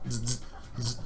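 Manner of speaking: soft, low insect bursts; quiet little buzzes; wasp buzz. Retold or imitated. Wasp waiting on the wall, wasp buzz.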